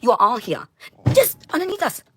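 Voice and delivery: high, loud furious screaming; screaming demands at everyone, voice cracking high; shrill furious rant. Real, not acted. Ranting at the world, furious high scream.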